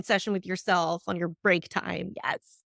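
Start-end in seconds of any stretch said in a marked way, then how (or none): none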